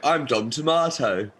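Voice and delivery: high, loud, drawn-out daft voice; stupid voice. Retold or imitated. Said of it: "In a really stupid voice as well".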